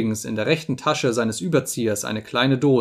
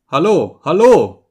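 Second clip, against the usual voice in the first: loud hail, louder the second time; calling out; 4 semitones higher, 9 dB louder, slower than usual. A narrator's reading. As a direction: call to someone approaching outside. Holmes hails approaching friend.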